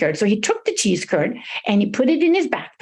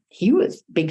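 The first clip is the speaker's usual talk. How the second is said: usual speaking voice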